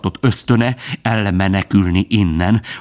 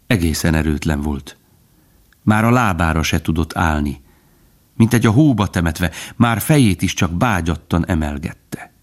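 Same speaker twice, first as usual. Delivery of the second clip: ordinary delivery, nothing marked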